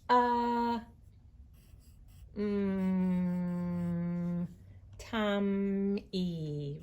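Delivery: tummy stretched slowly sound by sound. Shown as a demonstration. Teacher stretching tummy out for first graders. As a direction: pull each sound of tum-y apart. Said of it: "writing the sounds I hear".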